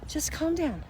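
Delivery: gently calming a little one; soothing a child. Retold or imitated. Adult calming a frantic little child, soft and high. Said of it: "What do we say to the little child?".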